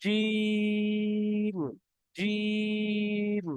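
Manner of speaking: জিম pressed, thick and held very long; long jim. Shown as a demonstration. Teacher demonstrating pressed, long jim to class. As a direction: stretch জিম slowly, pressing the j. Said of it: "চেপে পড়বো এবং আওয়াজটা মোটা করে পড়বো".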